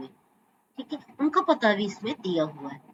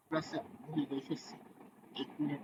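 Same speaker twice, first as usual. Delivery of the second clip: a low, much softer self-aside; half-voiced muttering as she searches memory; quiet aside; 9 semitones lower, 17 dB quieter, faster than usual. Real, not acted. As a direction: murmur to herself while recalling the verse. Forgets verse, mutters aside.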